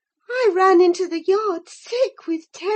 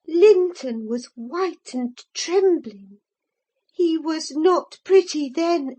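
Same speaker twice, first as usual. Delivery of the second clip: usual speaking voice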